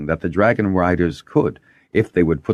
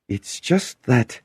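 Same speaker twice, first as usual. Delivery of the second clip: faltering; 9 semitones higher, slower than usual. A narrator's reading. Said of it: "when he faltered".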